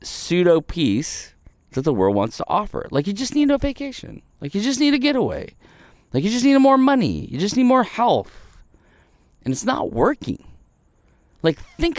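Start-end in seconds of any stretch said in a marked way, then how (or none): 2.9-8.6 high, quick dismissive voicing of the world's offers; mock worldly advice. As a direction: parrot the world's cheap fixes mockingly. Mocking the world's empty offers.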